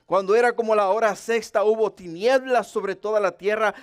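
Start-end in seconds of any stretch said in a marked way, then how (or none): none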